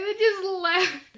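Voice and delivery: real laugh breaking through the words; can't hold the laughter in. Real, not acted. Telling the story of the church snort, laughing.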